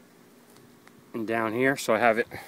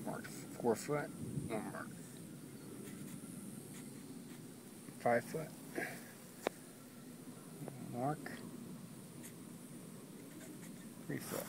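whispering; 3 semitones higher, 22 dB quieter, slower than usual. Real, not acted. Marking pipe, muttering to himself.

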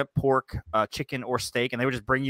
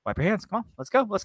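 higher mock-mom nagging, a touch pushy; mom hurrying kids; 8 semitones higher than usual. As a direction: lightly play a mom rushing the kids along. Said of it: "like a mom going".

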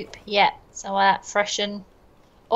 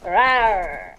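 playful roar; 4 semitones lower than usual. Pretend. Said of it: "she's an animal".

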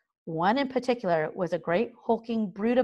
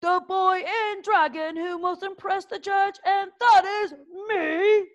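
gruff drill master; 12 semitones higher than usual. A narrator's reading. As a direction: lord it over the trembling boys. Gobber speaking.

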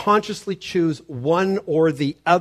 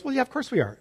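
high-pitched imitated husband's answer; acted-out "of course" in a high voice; mock breezy reply; 10 semitones higher, faster than usual. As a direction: toss it off high, as if obvious. Voices pastor answering his wife.

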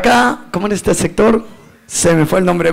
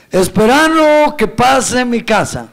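slower, stretched-out mock drunken answer; drawn-out drunken repeat; slower than usual. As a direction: stretch the words out for an officer who didn't get it. (repetición de borracho, lenta y alargada)

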